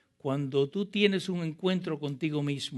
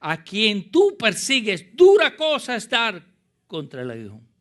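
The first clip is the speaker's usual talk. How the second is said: Jesús; 9 semitones higher, 13 dB louder than usual. Acted. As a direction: declare it with full preaching force. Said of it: "Y él le dijo".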